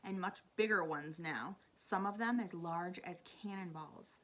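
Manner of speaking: ordinary delivery, nothing marked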